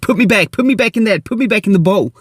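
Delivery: high, pleading cry of a suffocating fish; hammed-up panicked plea to be put back in the bowl. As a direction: beg to be put back like a fish gasping for air. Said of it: "And he's like".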